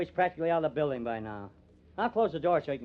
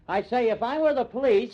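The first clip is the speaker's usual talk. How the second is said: raised, higher and louder, repeating the words over her; shouting; 6 semitones higher, 6 dB louder than usual. Acted. Reporter shouting his point at the suspect, loud and raised. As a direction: shout the line to drown her out. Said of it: "Jed, what are you shouting about?".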